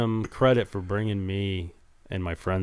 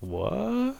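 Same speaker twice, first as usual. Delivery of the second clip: high startled what; shocked what; 12 semitones higher, 3 dB quieter than usual. Retold or imitated. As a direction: shoot the word up in shock. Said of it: "I was like".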